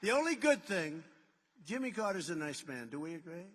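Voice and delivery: ordinary talk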